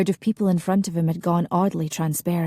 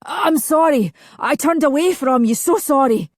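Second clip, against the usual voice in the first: anguished apology; 8 semitones higher, 5 dB louder than usual. A narrator's reading. Anguished apology to drowning brother.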